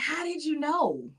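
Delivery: high, drawn-out mock astonishment; wide-eyed "how'd you know"; surprised retold question. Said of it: "And they'd be like".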